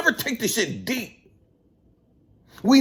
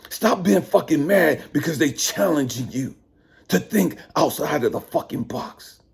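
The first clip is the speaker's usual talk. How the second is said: normal talking voice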